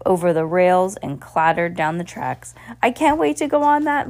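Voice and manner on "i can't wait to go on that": high, loud eager exclaim; excited cry. A narrator's reading. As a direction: burst out with excitement. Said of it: "Lucy cried".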